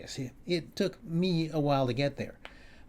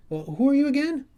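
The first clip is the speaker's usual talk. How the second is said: incredulous question; 13 semitones higher, 6 dB louder than usual. Retold or imitated. Questioning the intruder.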